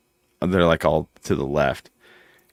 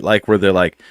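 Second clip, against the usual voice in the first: normal talking voice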